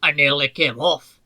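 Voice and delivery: loud, indignant complaint; fuming. A narrator's reading. Duncan storming up to the others, loud fuming. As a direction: blurt out the grievance hotly. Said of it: "fumed Duncan".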